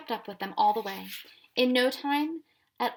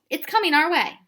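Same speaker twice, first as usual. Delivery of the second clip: higher, louder yell from up high; 7 semitones higher, 9 dB louder than usual. A narrator's reading. Tom yelling from the tree, a loud warning. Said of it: "he yelled".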